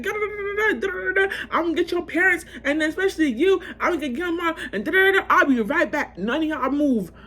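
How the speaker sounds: laughing imitation of a ranting mother; lightly voiced angry mom, giggling through it; ranting parent. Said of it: "she went off".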